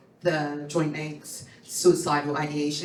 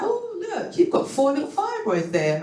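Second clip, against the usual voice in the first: a hint of a bright doctor, pitched up; chirpy doctor voice; 11 semitones higher than usual. Doctor pointing at the ultrasound, lightly mimicked high and chirpy. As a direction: mimic a cheerful sonographer. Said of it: "They're like".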